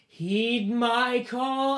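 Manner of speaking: long, high, drawn-out shout; yelled command. Acted. Boy commanding the demon from the circle, long shout. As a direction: call out the summons at full voice. Boy yells his summoning.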